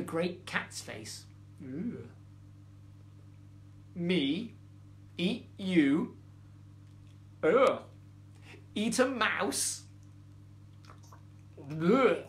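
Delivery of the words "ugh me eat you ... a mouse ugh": disgusted. Acted. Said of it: "screwed up her face".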